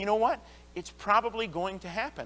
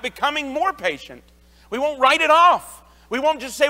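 louder, higher, driving sermon; forceful preaching; 6 semitones higher, 5 dB louder than usual. Acted. Pastor urges the congregation to be patient, preaching louder. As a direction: preach it home with conviction.

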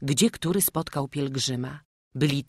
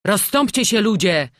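loud, raised crowd-clearing call; 6 semitones higher, 8 dB louder than usual. A narrator's reading. Someone in the crowd clears way for priest, loud call. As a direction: shout for people to make way. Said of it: "zawołał ktoś w tłumie".

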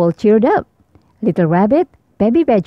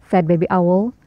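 ordinary delivery

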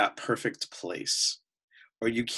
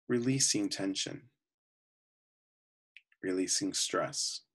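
calm, unhurried voice with long pauses between phrases; guided meditation; 4 dB quieter than usual. Real, not acted. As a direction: guide them gently into relaxation, often softening at phrase ends.

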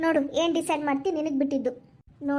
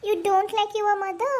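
ordinary delivery

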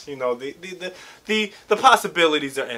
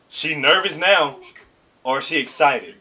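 ordinary talk, nothing marked

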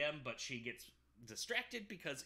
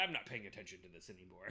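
tuned-out mumble; 8 semitones lower, 5 dB quieter, much faster than usual. Retold or imitated. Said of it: "and he's like".

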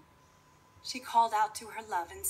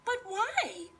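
fairy godmother voice; 5 dB louder than usual. Acted.

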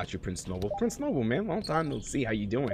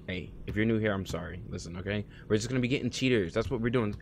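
ordinary talk, nothing marked

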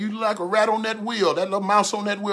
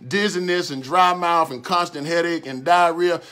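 usual speaking voice

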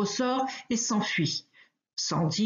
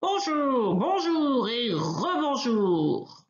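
higher, slow, gleeful teasing bonjours; elephant's mocking greeting; 4 semitones higher, slower than usual. Elephant spinning the wolf by the tail, gleeful mockery. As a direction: relish each bonjour while spinning him.

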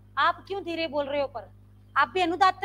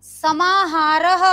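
समाहारः stretched long and even; drawn-out even recitation; 6 dB louder, much slower than usual. Shown as a demonstration. Teacher chants Sanskrit term slowly for students. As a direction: hold समाहारः slowly on one steady level. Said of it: "बराबर बोलना स्वरित है".

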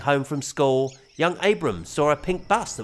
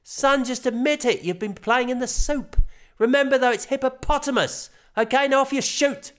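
lightly raised, corrective dad voice; patient father; 11 semitones higher than usual. A narrator's reading. Father correcting son's word, light patient dad voice. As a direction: correct him gently like a dad.

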